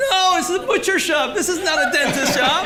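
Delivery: high, loud, laughing protest; laughing through a retold quote. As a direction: laugh out the words in disbelief. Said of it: "I was like".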